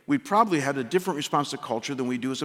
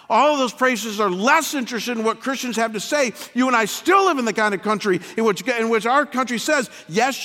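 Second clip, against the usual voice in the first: fiery preaching; 11 semitones higher, 8 dB louder than usual. Acted. Pastor rallying his congregation to engage culture, preaching at full force. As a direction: thunder it out to the congregation.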